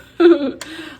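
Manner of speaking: high, loud giggle; laugh. Real, not acted. Joking with viewers about the posse, high giggle. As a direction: burst of laughter at your own joke.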